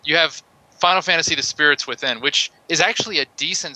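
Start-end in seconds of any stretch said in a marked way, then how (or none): none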